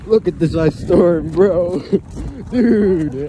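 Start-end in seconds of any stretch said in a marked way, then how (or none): 1.8-2.5 high laugh. Real, not acted. Laughs at the storm.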